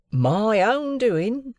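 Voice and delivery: slow, high, proud declaration; proud of his little castle. A narrator's reading. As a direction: show off your handiwork. Wemmick's pride.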